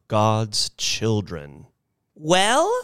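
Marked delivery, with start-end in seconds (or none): 2.1-2.7 put-on high questioning old-lady voice; expectant old lady. A narrator's reading. Missus Bellows turning to the others, expectant. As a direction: pitch it high and let it rise.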